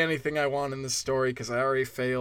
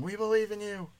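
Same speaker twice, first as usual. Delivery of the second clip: mock distant yell; 6 semitones higher, 4 dB quieter than usual. Imagined listeners yelling support from their cars, mock distant cheer. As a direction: imitate a crowd yelling from far away. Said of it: "and at work yelling".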